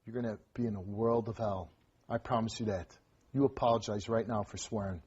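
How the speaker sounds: low, soft, menacing warning; quiet threat. Real, not acted. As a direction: warn him with voice dropped low. Host threatens defiant boy.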